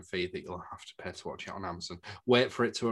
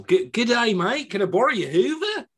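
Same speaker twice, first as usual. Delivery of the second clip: much higher, loud put-on Aussie voice; mock Australian accent; 12 semitones higher, 7 dB louder than usual. Teasing the film's cast with a hammed-up Australian accent. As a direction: ham up an Australian bloke asking a favour. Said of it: "Why is everyone Australian?".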